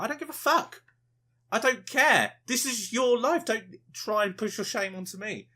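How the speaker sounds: high-pitched, indignant outburst; exasperated rant. Real, not acted. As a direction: throw it back at them, fed up. Ranting at vloggers who overshare.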